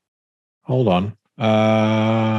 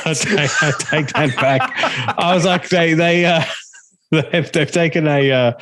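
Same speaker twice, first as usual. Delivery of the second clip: talking through laughter, pitched up; real laughing; 5 semitones higher than usual. Real, not acted. Host taking back his mistake about the festival lineup, laughing. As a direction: laugh while backing down.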